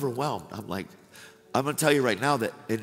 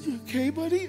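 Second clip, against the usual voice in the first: imitating Will. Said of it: "he goes".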